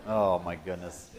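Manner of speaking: ordinary delivery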